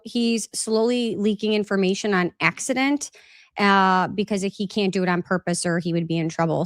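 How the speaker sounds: ordinary delivery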